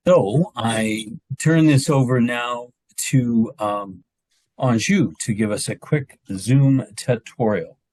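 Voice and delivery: usual speaking voice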